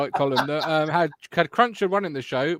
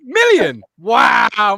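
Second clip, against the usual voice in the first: incredulous echo, much higher and louder; astonished; 5 semitones higher, 10 dB louder, much slower than usual. Real, not acted. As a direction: throw "Million?" back in disbelief, high and loud, falling at the end. Astonished at the guessed number.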